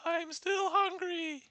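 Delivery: high, slow granny whine; drawn-out hungry old granny; wolf grandma. Acted.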